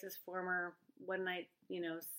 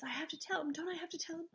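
anxious woman; 7 semitones higher, 5 dB quieter, faster than usual. Retold or imitated. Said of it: "she says".

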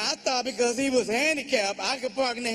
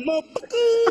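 high, louder brother voice again; imitating his brother; 11 semitones higher, 5 dB louder than usual. Comic repeating his brother's favorite word, high. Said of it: "That's his favorite word".

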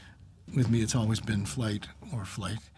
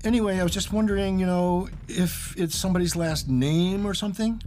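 nervous rambling; 6 semitones higher than usual. Retold or imitated. Nervous rambling to scary proprietor.

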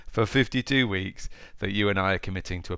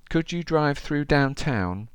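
put-on old lady voice; 7 semitones higher, slower than usual. Old lady quoted in story.

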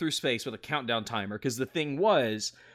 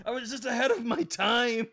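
Don Matrick rant; 9 semitones higher than usual. Pretend. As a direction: yell it like a vindicated exec. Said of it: "Just like".

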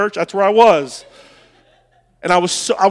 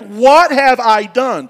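slow, louder anguished cry of regret; retold outburst; 5 semitones higher, 5 dB louder, much slower than usual. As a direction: hurl the question at the wife. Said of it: "I was just letting her have it".

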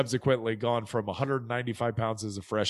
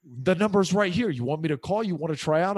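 put-on high-pitched, louder Chicago accent; imitated eager Chicago-accented buddy; 7 semitones higher, 4 dB louder than usual. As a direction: push a friend in Chicago-accented voice. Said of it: "He's got this accent".

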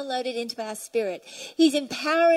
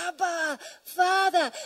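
drawn-out, yearning call; crying out; 3 semitones higher, slower than usual. Shown as a demonstration. The Spirit crying to the Father, a yearning cry. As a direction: stretch it out with longing, a little raised. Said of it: "in us cries out".

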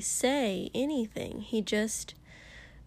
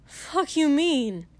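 snapping; 5 semitones higher, 5 dB louder, slower than usual. Acted. Katsuki snaps back.

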